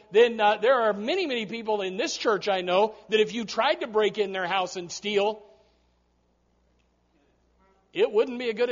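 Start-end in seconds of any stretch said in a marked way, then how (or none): none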